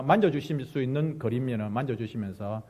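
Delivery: normal talking voice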